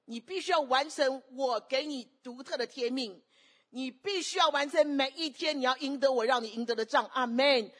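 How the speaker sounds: loud, high, emphatic divine charge; forceful pulpit declaration; commanding preaching. Acted. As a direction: proclaim it as God's command. Preacher voices God's command.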